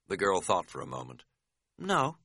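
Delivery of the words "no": simple, higher-pitched "No". A narrator's reading. Girl asked about giving up, answering simply. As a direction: answer artlessly, nothing added. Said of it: "she said simply".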